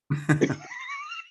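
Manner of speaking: big high burst of laughter. Real, not acted. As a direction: belly laugh. Big laugh at wife's line.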